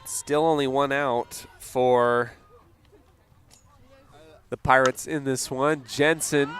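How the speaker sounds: usual speaking voice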